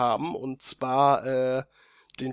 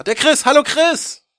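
high bright welcome; excited greeting; 12 semitones higher, 8 dB louder than usual. Real, not acted. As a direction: greet him with a big shout. Host introduces guest.

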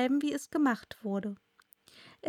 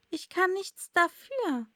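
modest Alice, higher and slow; bescheiden; 8 semitones higher than usual. A narrator's reading. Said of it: "sagte Alice bescheiden". (bescheiden, mit hoher Stimme)

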